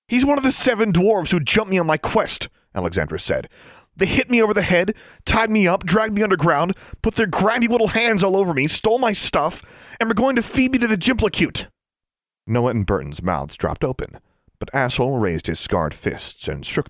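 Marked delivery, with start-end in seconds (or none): 0.1-11.7 high, fast outraged rant; higher, fast indignant rant; furious accusation. A narrator's reading. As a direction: list every grievance in fury.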